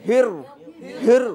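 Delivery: ordinary talk, nothing marked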